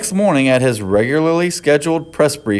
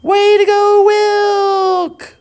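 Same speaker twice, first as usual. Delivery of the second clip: high, loud cheering crowd; 5 dB louder, much slower than usual. A narrator's reading. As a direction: cheer him on wildly. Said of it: "let out a loud cheer".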